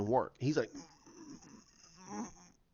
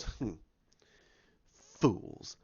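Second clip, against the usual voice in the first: contemptuous sneer; 5 semitones lower, 5 dB quieter than usual. Retold or imitated. Hux sneers at them.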